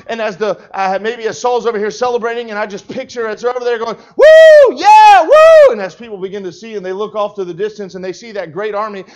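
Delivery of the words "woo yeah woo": high, loud cheering whoops; victory whoops. Acted. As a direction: whoop like a partying king. Saul celebrating.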